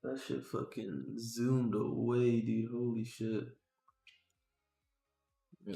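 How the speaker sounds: normal talking voice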